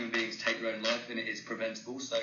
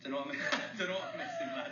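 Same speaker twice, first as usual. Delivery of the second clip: laughing, fast, higher imitation of a Manchester accent; mock Manchester accent; 8 semitones higher, faster than usual. Echoing the Manchester host's phrase back to him, laughing mock Manchester accent. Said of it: "I haven't heard that accent in a while".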